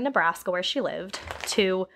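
ordinary talk, nothing marked

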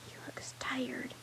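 slow, breathy whisper. Acted. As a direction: whisper it so he can't hear. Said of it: "Annie whispered".

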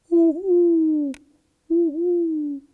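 high, loud owl hoots; loud high owl calls. Acted. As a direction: hoot like an owl in the dark. Owls hooting in the night.

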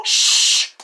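a quick whispered shush. Retold or imitated. Annoyed people shushing the narrator, whispered shh. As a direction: imitate someone shushing you. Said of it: "when people go".

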